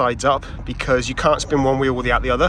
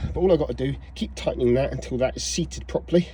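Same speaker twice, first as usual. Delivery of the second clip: usual speaking voice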